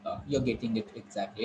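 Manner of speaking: ordinary talk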